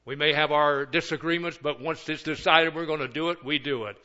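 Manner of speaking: ordinary delivery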